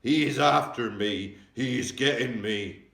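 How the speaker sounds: loud, slow, drawn-out panic; the giant crying out that someone is chasing him; frightened giant scream. A narrator's reading. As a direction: wail each word in terror. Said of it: "screamed the flesh lump eater".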